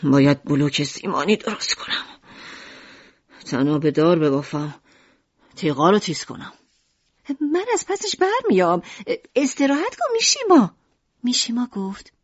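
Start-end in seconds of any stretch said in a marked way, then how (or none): 0.0-6.5 put-on lower, insistent old shopkeeper; husband refusing to rest, low and firm; stubborn father. Narrator voices Mishima. 7.4-10.8 high, bright-hearted mother's voice; Lucrèce playing the chipper wife; cheerful mother.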